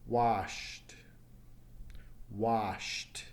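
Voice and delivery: "Washed" with a crisp voiceless T after sh; final T sound. Shown as a demonstration. Teacher models past tense of wash, final T sound. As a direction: say "Washed" as one syllable ending in T. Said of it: "Hear the T at the end".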